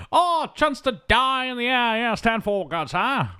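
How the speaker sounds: high, slow, hammed-up old pilot; Winston. Pilot offered a chance to die flying, hammed-up eagerness. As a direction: stretch it out in mock eager bravado. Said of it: "link up with Winston".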